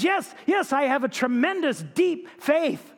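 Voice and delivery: high, breathless eagerness; eager frantic yes. Acted. Clinging man assuring the voice of his faith, high and frantic. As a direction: blurt it out, pitched high and desperate.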